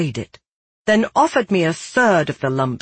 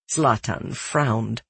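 ordinary delivery

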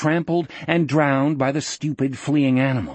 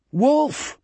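high, urgent shouted name; 11 semitones higher than usual. A narrator's reading. Jack calling to Wolf over the noise, shout. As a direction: shout to reach someone out of earshot. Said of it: "Jack shouted".

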